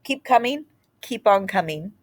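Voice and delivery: ordinary delivery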